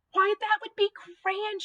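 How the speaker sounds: put-on high-pitched delight of an old lady. A narrator's reading. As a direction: accept with delight, voice lifted high. Delighted old woman accepts friendship.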